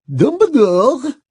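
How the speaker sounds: usual speaking voice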